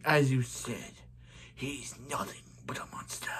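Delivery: hushed villain, sinking into a whisper; soft, whispery villain voice; Zoom voice. Acted. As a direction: slide down into a menacing whisper. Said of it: "I do a very good Zoom voice".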